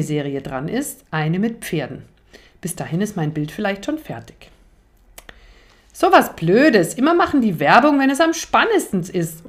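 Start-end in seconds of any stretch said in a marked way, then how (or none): 5.9-9.5 höher und lauter empörter Kinderruf; laut über die Werbung meckern; viel zu laut. Acted. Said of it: "ruft mein Bruder plötzlich viel zu laut". (Leo schimpft laut vor dem Fernseher über die Werbung)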